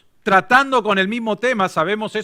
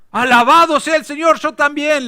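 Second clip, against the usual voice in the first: higher, louder joyful exclamation; overjoyed; 9 semitones higher, 5 dB louder than usual. Acted. Rescuer exulting that the jumper is also Baptist, joyful. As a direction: burst out in happy praise.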